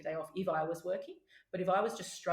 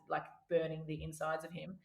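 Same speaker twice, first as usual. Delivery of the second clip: usual speaking voice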